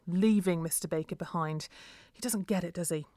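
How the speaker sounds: ordinary delivery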